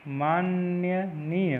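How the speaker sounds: माननीय very slowly as "maanya-neey"; stretched maan-nya-neey for माननीय; wrong pronunciation. Shown as a demonstration. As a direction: drag out माननीय with an extra y after the n. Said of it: "माननीय नहीं है".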